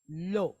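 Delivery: "lo" with an open O sound; L plus O. Shown as a demonstration. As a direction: sound out "lo" as one syllable, L then long O. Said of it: "make an O sound".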